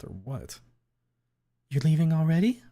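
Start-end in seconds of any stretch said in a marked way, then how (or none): 1.7-2.6 higher, louder questioning voice; her question voiced. A narrator's reading. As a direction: ask lightly in a woman's voice. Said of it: "she asked me".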